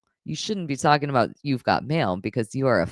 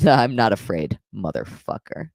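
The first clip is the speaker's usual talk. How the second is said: usual speaking voice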